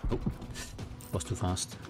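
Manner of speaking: normal talking voice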